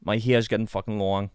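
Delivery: put-on Boston accent with swallowed r's. Shown as a demonstration. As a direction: grouse in broad Bostonian. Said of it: "Boston's quite".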